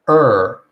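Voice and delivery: loud, held "Er" with rounded lips; er sound demo. Shown as a demonstration. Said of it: "it looks like this".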